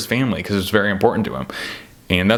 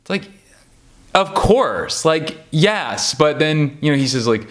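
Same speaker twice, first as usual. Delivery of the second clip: normal talking voice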